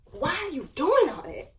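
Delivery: high, loud mock angry dad; dad yelling on the phone. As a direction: throw your voice up like a scolding father. Dad calling, voiced loud.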